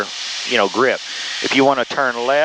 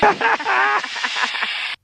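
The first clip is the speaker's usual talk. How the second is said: on a roller coaster. Real, not acted. Thrilled passenger after a pull.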